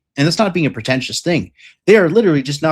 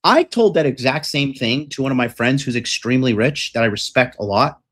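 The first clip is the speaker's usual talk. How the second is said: usual speaking voice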